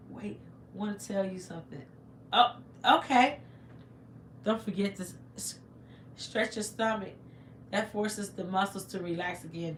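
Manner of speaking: ordinary delivery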